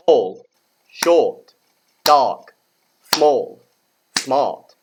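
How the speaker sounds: "Tall, short, dark, small, smart" as loud separate beats; one-syllable beats. Shown as a demonstration. Teacher shows words are one syllable, loud single beats. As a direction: punch each word from "Tall" to "smart", each falling. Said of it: "They're all one syllable".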